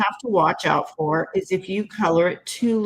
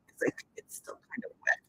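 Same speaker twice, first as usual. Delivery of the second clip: whisper; 12 dB quieter than usual. Real, not acted. Aside while handling wet card.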